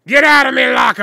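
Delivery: shouted pirate voice, high and loud; Davy Jones. Acted. Mimicking the Curse Cove announcer, shouted pirate voice. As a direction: bellow it like a pirate captain. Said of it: "Curse Cove has Davy Jones".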